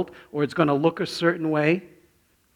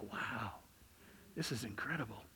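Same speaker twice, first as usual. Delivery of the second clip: soft, amazed hush; awed whisper touring the church; hushed awe; 4 semitones lower, 21 dB quieter than usual. Retold or imitated. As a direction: breathe it out in wonder. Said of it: "all I could hear him say".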